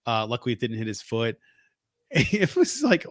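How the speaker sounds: normal talking voice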